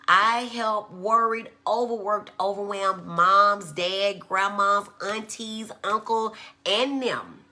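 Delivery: slow, loud, drawn-out tagline; emphatic sign-off. Shown as a demonstration. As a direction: sell the list like a closing pitch. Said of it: "her sign-off tagline, delivered big".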